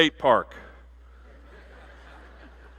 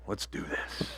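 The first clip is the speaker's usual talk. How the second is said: whisper; 16 dB quieter, slower than usual. Acted. Bride's eager line.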